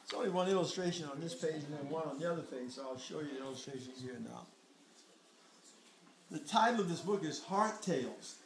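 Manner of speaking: ordinary delivery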